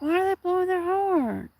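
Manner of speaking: imitating her own confusion at the noise; puzzled. Hearing the mail van honk, with mild puzzlement. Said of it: "I'm like".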